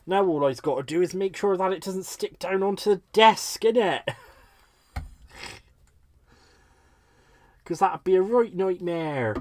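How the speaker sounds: put-on playful West Country accent with some push. Crafter making sure it doesn't stick to desk, West Country accent. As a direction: grumble jokily in West Country dialect.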